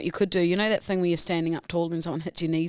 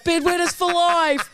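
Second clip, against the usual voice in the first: high, slow, drawn-out chant; mock rallying cry; 9 semitones higher, slower than usual. A stranger shouting after kicking Lou's knees, slow mock cry. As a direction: stretch it out like a prankster's battle cry.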